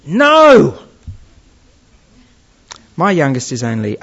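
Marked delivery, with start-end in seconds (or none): 0.0-0.8 loud, high imitation of a screaming toddler; screamed child's defiance. Parent imitating a child who stamps their little foot, loud high scream. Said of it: "scream back in your face".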